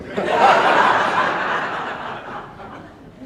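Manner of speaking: high, loud wheezing laughter; big laugh. Real, not acted. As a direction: burst out laughing at the dare. Laughing at teammates' dare.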